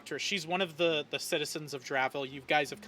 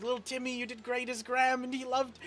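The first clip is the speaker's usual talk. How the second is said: higher, lightly voiced cheery carer; praising a child; 7 semitones higher than usual. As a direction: cheer on a little kid. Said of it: "she's like".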